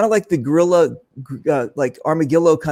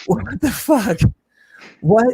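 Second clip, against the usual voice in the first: shocked disbelief; 8 semitones higher, slower than usual. Retold or imitated. Retold shock at overnight offer.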